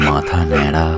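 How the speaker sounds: normal talking voice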